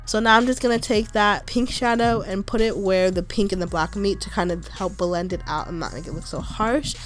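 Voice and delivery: normal talking voice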